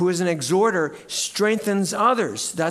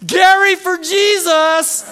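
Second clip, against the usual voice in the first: very high, loud, stretched yell; imitated yell; 13 semitones higher, 10 dB louder, slower than usual. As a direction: holler it from the field like a fan. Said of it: "He goes".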